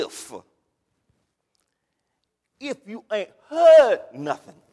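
put-on a light Louisiana preacher accent, slow and drawn out. Pastor imitating a Louisiana preacher for his congregation, slow Louisiana drawl. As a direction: lean into an old Louisiana preacher's cadence, slow. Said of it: "my best Louisiana imitation".

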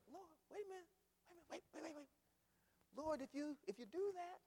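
high, hushed interruption; small high voice, hastily objecting; anxious interceding Moses. Acted. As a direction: softly stammer a high protest. Moses interrupts God, acted.

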